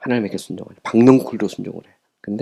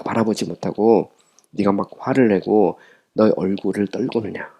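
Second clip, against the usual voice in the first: usual speaking voice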